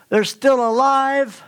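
high, slow and loud for emphasis; emphatic peak. Real, not acted.

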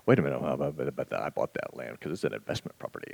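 low, soft, quick grumble; muttered objection. Retold or imitated. A landowner in his head objecting to giving. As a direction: grumble to yourself defensively. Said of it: "I can already hear it in my mind".

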